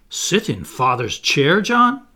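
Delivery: loud disbelief leaping high on the name; scandalized. A narrator's reading. Wendy to John about Father's chair, scandalized. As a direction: question him as if shocked, rising sharply. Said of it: "Wendy was scandalized".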